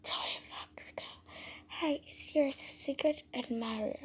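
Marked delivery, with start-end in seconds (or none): none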